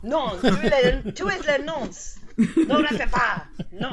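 high, loud, slow mock French; giggly hammed-up French outcry; mock French children. Joins in mock French protest.

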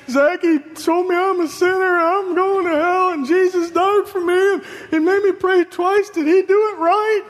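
put-on high, whiny little-boy voice; imitating crying child. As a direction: play a small boy blurting it out through mock tears. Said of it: "Calvin's crying".

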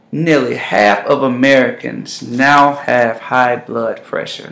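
usual speaking voice

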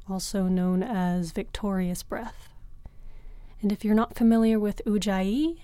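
ordinary delivery

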